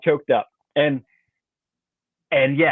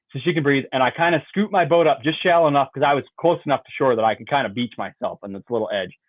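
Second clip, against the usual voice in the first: ordinary talk, nothing marked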